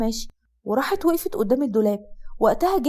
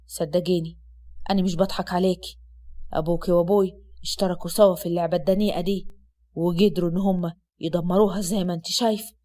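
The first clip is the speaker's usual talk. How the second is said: lowered, steady man's voice; Asad's low male voice; 7 semitones lower than usual. Acted. Husband comforting his sick wife, low male voice. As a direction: speak as the calm husband, low.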